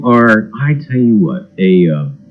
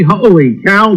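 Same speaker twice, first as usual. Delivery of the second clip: ordinary talk, nothing marked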